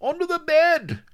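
very high, loud strained call from under furniture; squeezed, smothered high voice; muffled reply. A narrator's reading. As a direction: shout as if muffled by a bed. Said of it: "came the muffled reply".

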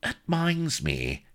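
high, slow, wheedling; pathetic old rustic. A narrator's reading. Solomon paws at Blood's sleeve, pathetic wheedling. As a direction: beg like a pitiful castaway. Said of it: "he pawed pathetically at Blood's sleeve".

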